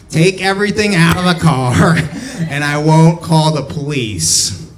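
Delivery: high, loud mocking nerd impression. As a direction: do the manager as a squawking nerd. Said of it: "he was a nerd, so he sounded".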